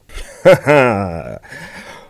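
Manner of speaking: loud warm chuckle. Acted. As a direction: laugh it off easily. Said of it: "Kunta chuckled".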